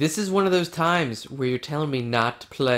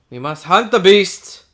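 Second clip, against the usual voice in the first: slow, loud, higher royal proclamation; mock king; 4 semitones higher, 9 dB louder than usual. King rallying his hunting partner, hammed-up and slow. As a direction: declare it like a hammy medieval king.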